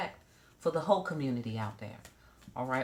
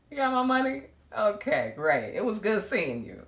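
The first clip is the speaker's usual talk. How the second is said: higher, louder, cheerful dismissive voice; breezy professional hoe; 5 semitones higher, 6 dB louder than usual. Retold or imitated. Imitating a professional cheerfully dismissing a client, higher and louder. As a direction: take the cash and wave goodbye. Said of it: "The hoe was like".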